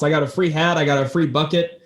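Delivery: usual speaking voice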